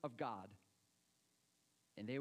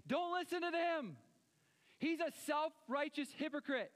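raised, loud mock warning; Jesus yelling; 8 semitones higher, 3 dB louder than usual. Jesus yelling at a churchgoer, high loud warning. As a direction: call it out high and sharp. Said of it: "yelling at me going".

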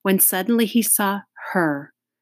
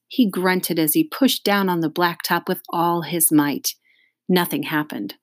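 plain reading voice

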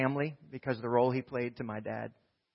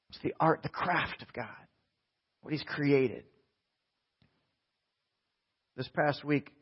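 normal talking voice

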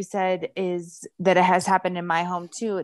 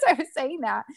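usual speaking voice

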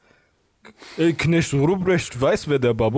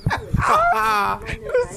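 shrieking laugh. Real, not acted. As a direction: burst out in a squealing laugh. Lacht über vorgelesenes Gedicht.

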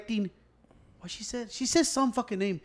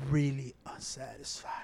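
quiet; 8 semitones lower, 12 dB quieter than usual. Retold or imitated. Retold answer to pollster.

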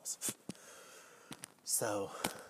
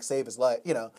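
ordinary talk

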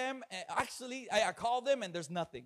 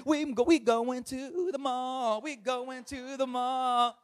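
drawn-out, singsong jubilation; celebrating; 3 semitones higher, slower than usual. Acted. Pastor telling the church the good news, jubilant. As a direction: stretch it into a gleeful little chant.

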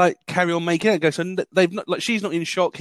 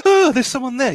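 mock surprise; 9 semitones higher, 5 dB louder than usual. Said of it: "it's like".

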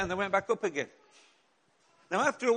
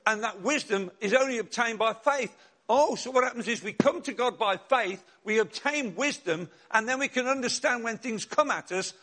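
preaching; 3 semitones higher, 4 dB louder than usual. Acted. Pastor preaching to the church with conviction.

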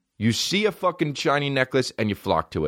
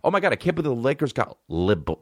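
ordinary talk

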